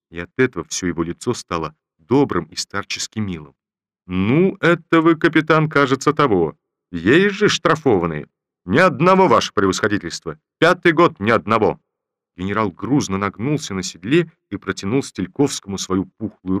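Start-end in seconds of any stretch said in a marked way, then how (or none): 4.1-8.3 put-on louder, higher teasing old general. General teases captain. 8.7-11.8 loud firm stress on одного; captain firmly denying to the general; firm denial. Acted.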